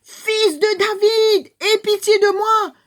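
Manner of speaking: high, slow, loud plea; crying out. Acted. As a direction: beg at the top of your voice. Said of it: "Qu'il a crié".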